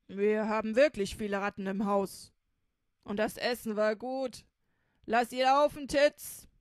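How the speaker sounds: robber voice. Acted. Robber telling Titz to let the cat go, loud easygoing voice. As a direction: wave the matter away.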